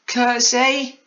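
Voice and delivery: put-on very high, loud child's shout. A narrator's reading. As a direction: yell it out in a squeaky pitch. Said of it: "wiggled her arms up and down and shouted".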